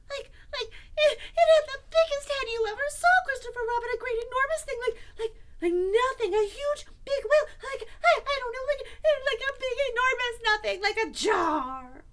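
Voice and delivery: high, loud, stammering squeal; panicky Piglet. Acted. Piglet describes the monster to Christopher Robin, high panicked stammering. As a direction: gabble in a high fright, groping for words.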